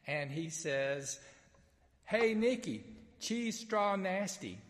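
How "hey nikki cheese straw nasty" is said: put-on loud, high Greek mangled by a southern accent; comic southern-accented attempt at a Greek greeting. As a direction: holler botched Greek in a broad southern accent. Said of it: "speak Greek in my southern accent".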